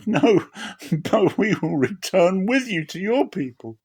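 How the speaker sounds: put-on young women refusing to leave; daughters-in-law pleading. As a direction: beg to stay, voice lifted high.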